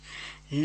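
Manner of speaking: put-on soft, low old man's chuckle; dry little "heh-heh". A narrator's reading. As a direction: chuckle quietly under the breath. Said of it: "นานอินหัวเราะหึ่ๆ".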